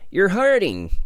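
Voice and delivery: high-pitched storybook yelp of protest; crying out. A narrator's reading. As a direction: cry out in mild pain. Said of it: "cried Pooh".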